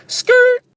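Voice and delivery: mimicked tyre screech; squealing tyres, high and sharp. Tyre screech sound effect.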